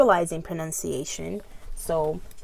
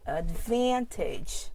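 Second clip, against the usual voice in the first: advantage slowly, VAN drawn out; stress on VAN; much slower than usual. Shown as a demonstration. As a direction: stretch the middle of advantage. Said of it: "I punch every time I say the stress word".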